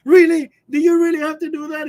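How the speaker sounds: high, loud mock exasperation; imitating exasperated friend. Friend scolds caller for riling the dog, mock exasperation. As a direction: scold like a fed-up friend, retold. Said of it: "this close to cussing me out".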